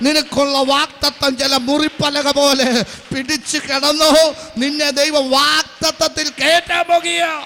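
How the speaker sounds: loud praise building to a high shout; full-force preaching. Real, not acted. Preacher urging the wrecked to hold on to the promise, loud fervent preaching.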